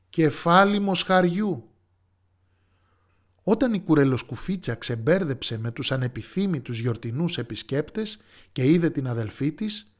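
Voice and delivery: ordinary delivery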